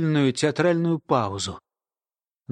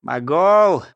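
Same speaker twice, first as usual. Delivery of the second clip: put-on old woman's doubtful echo of the name; mildly scoffing single word; scornful snort; 5 dB louder than usual. A narrator's reading. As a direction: snort the word back dismissively. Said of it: "Старуха фыркнула".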